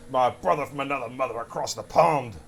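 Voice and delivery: a rough stab at a Texan drawl; lightly hammed-up Texan drawl; attempted Texan drawl. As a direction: put on an amateur Texan accent. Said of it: "better than I can do the Texan drawl".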